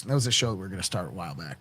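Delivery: usual speaking voice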